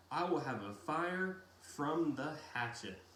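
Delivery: ordinary delivery, nothing marked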